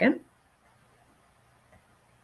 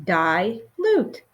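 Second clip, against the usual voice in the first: Dilute split into di, pause, lute; syllable by syllable; 2 semitones lower than usual. Shown as a demonstration. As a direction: model "Dilute" as two separate beats. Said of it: "What word did we rebuild?".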